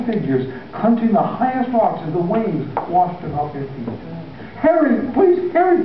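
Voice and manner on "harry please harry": high, pleading call; father crying out to his son; cried out. A narrator's reading. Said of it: "he cried".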